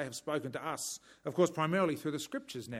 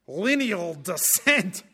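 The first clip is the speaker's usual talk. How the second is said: put-on broad, slow Scottish accent, louder; 3 semitones higher, 8 dB louder, much slower than usual. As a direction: roll out Lineal descent like a Scottish preacher. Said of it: "I'll try and get the Scottish accent".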